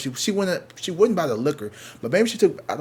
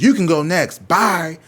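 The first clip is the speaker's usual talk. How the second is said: loud, higher mock send-off; curt dismissal; 6 semitones higher, 9 dB louder, slower than usual. Clerk sending the boyfriend away, loud mock dismissal. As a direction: imitate an angry clerk ordering someone out.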